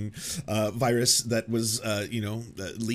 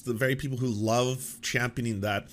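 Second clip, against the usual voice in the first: ordinary talk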